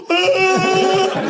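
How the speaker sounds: high, long mock bawl; hammed-up loud sob; loud crying wail. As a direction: wail like a big baby. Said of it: "I'm a loud crier too".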